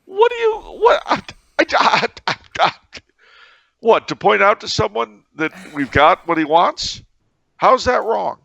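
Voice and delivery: high sputtering outburst, stammering the I's; hammed-up spluttering innocence; mock indignation. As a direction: stammer in playful outrage.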